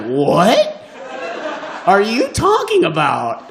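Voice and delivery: mock-bewildered shout; baffled. The comic protesting her refusal, loudly baffled. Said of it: "And I was like".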